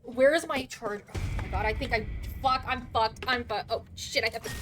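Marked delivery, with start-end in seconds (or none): none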